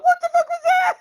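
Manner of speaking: high, loud startled cry of disbelief; shocked outburst. Retold or imitated. To Paul when the screen died, a high loud shocked yell. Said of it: "And I looked at Paul and I went".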